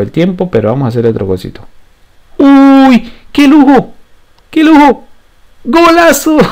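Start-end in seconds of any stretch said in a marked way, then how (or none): 2.4-6.4 very high, loud goal screams; breakneck goal commentary. Real, not acted. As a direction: scream the bicycle-kick goal. Bicycle-kick goal scored.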